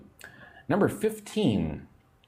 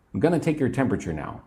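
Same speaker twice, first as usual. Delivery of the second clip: gonna rushed at quick natural speed; fast casual American; much faster than usual. Shown as a demonstration. Teacher shows the fast American gonna version. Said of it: "In America, you might say".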